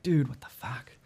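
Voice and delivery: quoted under the breath, near-whisper; retold stunned whisper; hushed disbelief. Stunned friend at the missing bags, hushed disbelief. Quoting stunned companion at baggage claim.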